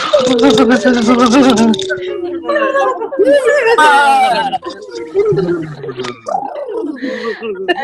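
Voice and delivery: long, loud, high burst of laughter; loud laughing burst. Real, not acted. Coach leading the group's silly sound exercise, laughing loudly. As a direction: let a real silly laugh roll out. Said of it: "I'm gonna do something kinda silly".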